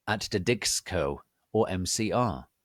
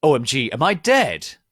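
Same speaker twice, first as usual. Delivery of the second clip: panicked shout; 5 semitones higher, 9 dB louder than usual. A narrator's reading. Heroine panics about being dead.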